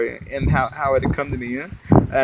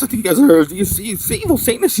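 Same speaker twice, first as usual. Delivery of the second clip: agitated accusation; 10 semitones higher, 6 dB louder than usual. Retold or imitated. Accusing people of devil worship.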